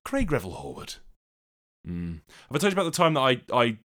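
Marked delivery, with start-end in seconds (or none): 0.1-2.2 low camp judge voice; Craig Revel Horwood. Acted. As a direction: announce the judge like on TV. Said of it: "time to go into the judges' scores".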